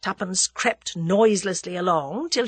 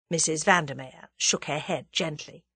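plain reading voice

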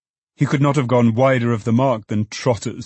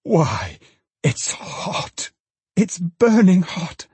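cry of rapture; 9 semitones higher, much slower than usual. A narrator's reading. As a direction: build into a slow, rapturous exclamation. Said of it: "and cried out in a rapture".

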